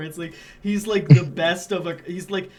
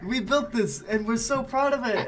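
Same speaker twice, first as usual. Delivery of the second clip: proud French builders; 6 semitones higher, 8 dB louder than usual. Acted.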